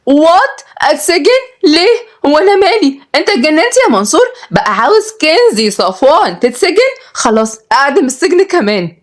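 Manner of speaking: very high, outraged disbelief; shocked. A narrator's reading. Heiress told she may be jailed, shocked. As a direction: fire off scandalised questions in a high voice. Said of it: "اتصدمت كنزي".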